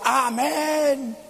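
long drawn-out mock cry of a congregation; congregation's mock shout. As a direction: imitate believers crying out, without real volume. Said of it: "todos los hermanos gritaban".

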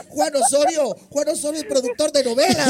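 loud, high-pitched disbelief; exasperated football buffs. Acted. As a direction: exclaim it like an outraged expert. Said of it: "deben de estar retorciendo su vena de que".

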